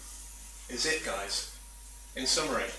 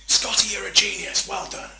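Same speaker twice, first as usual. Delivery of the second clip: higher and louder, thrilled captain; excited praise; 8 semitones higher, 8 dB louder than usual. Acted. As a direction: cheer him on like a relieved captain. Kirk praises Scotty in a skit.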